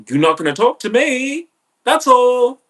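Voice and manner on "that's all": "That's all." pitched way up and pushed louder; a high sharp loud "That's all." to close the subject; loud high final "That's all.". Shown as a demonstration. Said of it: "repeats phrase two ways".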